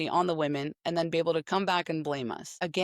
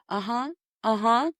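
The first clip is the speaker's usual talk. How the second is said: mock bored uh-huhs, slightly higher; bored phone escort; 5 semitones higher than usual. Imitating a phone escort humoring a talking man, mock bored.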